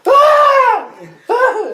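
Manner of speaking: high, loud laughing scream; mock screams of panic, laughing; imitated scream. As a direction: shriek like a terrified kid, laughing through it. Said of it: "I screamed like the, the bitch".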